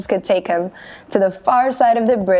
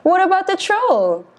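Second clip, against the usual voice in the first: worried cry; 11 semitones higher than usual. Acted. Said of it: "cried Middle Billy Goat Gruff".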